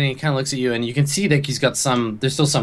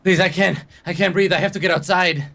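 higher-pitched breathless plea; acted touch of panic, voice raised in pitch; panicking; 6 semitones higher than usual. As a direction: beg to get out, mildly panicked.

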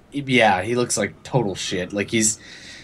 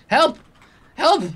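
high-pitched cry for help; acted panicked yell, short and high; shouting; 4 dB louder than usual. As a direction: call out high, as if to the whole ship. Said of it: "he's still shouting".

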